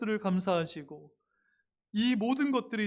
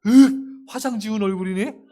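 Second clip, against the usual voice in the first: a sudden loud startled yelp, then a laughing jab; a husband waking to his wife's bare face, in mock horror; mock shock; 2 semitones higher, 11 dB louder than usual. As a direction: recoil at the sight, laughing. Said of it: "이렇게 놀라시면 안 돼요".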